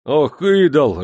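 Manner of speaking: loud angry growl; furious soldier. A narrator's reading. Soldier cursing the Bashkir at the door, angry and loud. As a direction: curse him in a rage. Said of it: "рассвирепел солдат".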